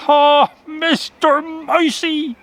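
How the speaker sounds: high, slow despairing wail; a frightened rabbit crying out to his friend; cried aloud. A narrator's reading. Rabbit alone on the storm-tossed ship, crying out in despair. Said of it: "he cried aloud".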